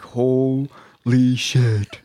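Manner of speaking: slow, drawn-out disbelief; retold stunned awe. Recruits watching chaos through porthole, slow retold awe. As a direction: stretch it out, stunned.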